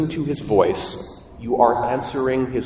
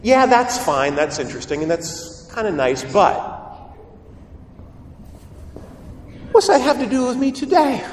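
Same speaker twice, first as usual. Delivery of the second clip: higher-pitched imitation of a doubting listener; sceptical listener; 9 semitones higher than usual. Listener objecting to a talk on vocation, sceptical. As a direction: act out an unconvinced congregant's objection. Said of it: "you might say".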